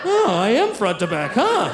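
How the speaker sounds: mock the slow realization, laughing. Imitating audience realization.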